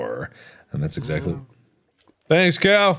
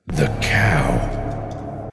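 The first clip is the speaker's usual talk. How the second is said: usual speaking voice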